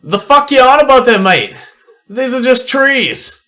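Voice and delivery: high, loud scoffing dwarf; angry dwarf voice. Acted. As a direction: bark it out as a cocky dwarf.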